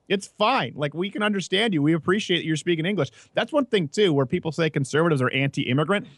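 ordinary delivery

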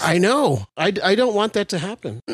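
high-pitched, plaintive and crestfallen; sad, cracking voice. Real, not acted. Fan lamenting to friends the end of physical media, sad. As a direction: let your voice rise and crack with disappointment. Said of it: "hear the sadness in his voice".

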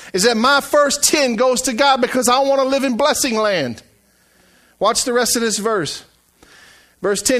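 emphatic pulpit delivery, building toward the challenge; fervent preaching. Acted. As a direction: proclaim the promise to a congregation. Preaches and reads the verse aloud.

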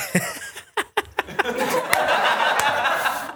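high, helpless giggling; real laughing fit. Real, not acted. Comic laughing over his botched shirt line, helplessly. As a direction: crack up at your own slip. Cracks up at his own slip.

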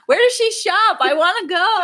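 high eager squeal; excited. Real, not acted. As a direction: gush with delight. Excited about leather cat pants.

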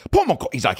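high-pitched British manager caricature. Retold or imitated. Chauffeur manager protects [name], high British caricature. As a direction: squawk it as a cartoon British minder. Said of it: "a caricature of a, of a British guy".

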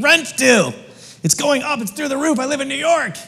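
lightly voiced worried tenant; a hint of money panic; anxious inner voice. Preacher voicing his own rent anxiety, mock worried. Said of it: "I'm like".

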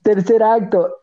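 usual speaking voice

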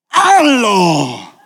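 sharp crying shout at her husband; wife shouting. Retold or imitated. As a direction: yell it like a fed-up wife. Wife orders husband to kneel.

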